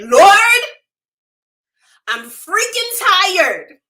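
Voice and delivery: loud, high, drawn-out exasperated cry; retold angry yell, slow and high; yelling out to God. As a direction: holler it heavenward, fed up and worn out. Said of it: "I yelled out to God".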